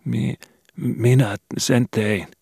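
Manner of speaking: put-on high, slow, faltering child's confession; trembling voice. Skobe confessing the murder to the detective, high and trembling. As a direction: quaver it out, barely daring to speak. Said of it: "sai sanotuksi vapisevalla äänellä".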